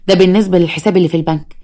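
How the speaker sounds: plain reading voice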